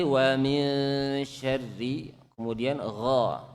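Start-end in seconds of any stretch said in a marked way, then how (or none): 0.0-2.1 wa min syarri, slow and drawn out; slow tajwid recitation of wa min syarri; Quranic recitation. Shown as a demonstration. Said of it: "sama dengan tadi".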